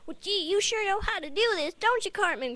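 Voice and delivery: very high, eager little cartoon boy; squeaky, innocent kid impression; Butters. Sidekick admiring his friend's break-in skills, high naive cartoon voice. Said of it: "Butters like".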